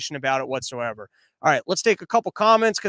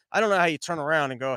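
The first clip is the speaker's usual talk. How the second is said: ordinary delivery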